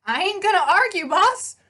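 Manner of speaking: high, brash horse voice with a street twang; pegasus voice. Acted. The pegasus greets his rider in a high, brash horse voice. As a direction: play the cheeky winged horse, all swagger.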